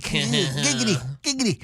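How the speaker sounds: high slow sleazy Giggity; sleazy cartoon catchphrase, drawn out; Giggity impression. Quagmire catchphrase.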